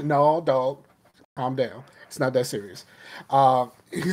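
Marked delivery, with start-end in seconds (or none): none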